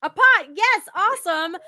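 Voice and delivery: high, loud burst of congratulation; real delighted cheering; excited praise. Real, not acted. Host cheers guest's answer.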